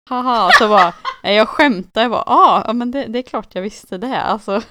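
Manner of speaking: mock forced joviality. Retelling a work call with missed sarcasm, loud mock bluffing. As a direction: overplay pretending to be in on it.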